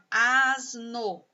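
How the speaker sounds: "asno" drawn out in two syllables. Shown as a demonstration. Teacher showing two sounds to pupils, "asno" syllable by syllable. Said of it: "Asno tem dois sons".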